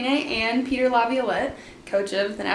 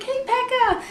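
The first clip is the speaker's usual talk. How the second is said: much higher, small-voiced hello; meek fan greeting; 13 semitones higher than usual. Fan really greets the goalie on the carpet, high and meek. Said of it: "Really didn't say that. I was like".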